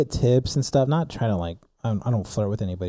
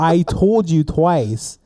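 louder, slow, higher retort; getting angry, retold; 4 semitones higher, 5 dB louder, much slower than usual. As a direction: drag out an exasperated retold complaint. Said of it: "So now I'm getting angry".

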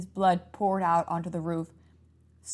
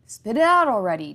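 loud, higher, impatient snap; 4 semitones higher, 9 dB louder than usual. A narrator's reading. Brother interrupts his stammering sister impatiently. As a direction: cut her off, fed up. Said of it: "Jack interrupted".

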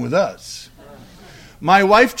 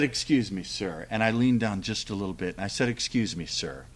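quiet, low and gentle; soft respectful address; 10 semitones lower, 11 dB quieter than usual. Retold or imitated. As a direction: lean in and speak softly to him. Leans down to speak softly.

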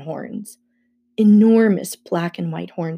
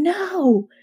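urgent no; 10 semitones higher than usual. A narrator's reading. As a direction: cry out no in urgent refusal. Urgent thought pleading with friend.

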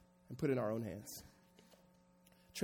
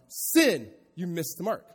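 official yelling out; 3 semitones higher, 11 dB louder, slower than usual. Retold or imitated. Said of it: "the official would yell out".

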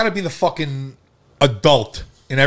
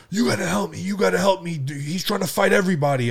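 imitated panicked pleading, higher pitch; retold frantic plea; 6 semitones higher than usual. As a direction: beg for help as if retelling someone's panic. Retold girlfriend begging for help.

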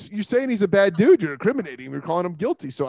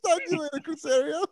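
words squeezed out through laughter; laughing through it; 8 semitones higher, 5 dB quieter than usual. Real, not acted. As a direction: laugh your way through the goodbye. Laughing while ending the mock call.

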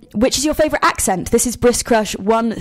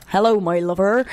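stock Bristolian greeting in mock accent. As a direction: put on a broad Bristol accent. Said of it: "In Bristol".

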